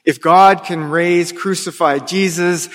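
ordinary delivery

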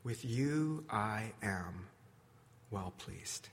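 slow, low, hushed blessing; soft and unhurried, sinking almost to nothing; tender Jesus. Acted. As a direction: speak low, as a quiet assurance. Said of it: "Jesus stands alongside me and says".

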